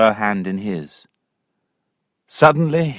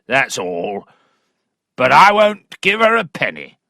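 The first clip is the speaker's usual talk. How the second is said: furious squire. Acted. Angry father shouting at Allworthy about his daughter. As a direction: roar it like a raging country squire.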